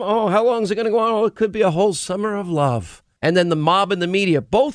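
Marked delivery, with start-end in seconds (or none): 0.0-3.0 higher, fast, sing-song mock of the mayor. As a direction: toss it off like a clueless shrug, mocking. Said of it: "the ridiculousness of, of saying".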